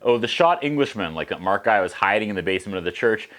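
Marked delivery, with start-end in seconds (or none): none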